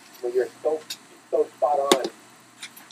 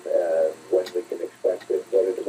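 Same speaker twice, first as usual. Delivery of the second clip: normal talking voice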